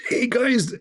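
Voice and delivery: high, mocking whisper. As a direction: hiss it like a secret tip-off. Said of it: "Like somebody told them".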